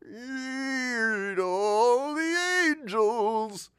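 very slow, high, wailing backmasked voice; eerie slowed reverse-playback wail; backwards record. Retold or imitated. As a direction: moan it like a record played in reverse. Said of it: "in case we wanted to run it backwards".

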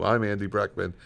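normal talking voice

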